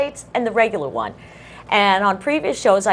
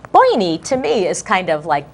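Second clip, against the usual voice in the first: normal talking voice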